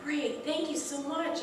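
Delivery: high, bright-eyed grateful thanks; chirpy thanks. Retold or imitated. Thanking the tow truck driver, high and eager. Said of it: "And I'm like".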